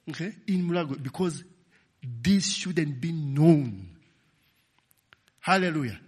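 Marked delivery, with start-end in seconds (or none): 2.0-3.9 very slow, deliberate stress; slow preacher emphasis. Acted. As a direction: spell it out word by word.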